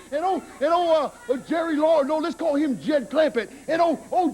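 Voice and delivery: normal talking voice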